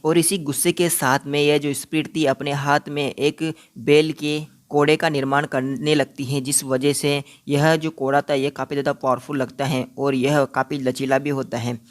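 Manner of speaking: ordinary delivery, nothing marked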